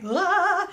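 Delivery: high bright "Ah" with big open mouth; bright jazz-hands ah. Shown as a demonstration. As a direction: belt a showy ah. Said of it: "jazz hands".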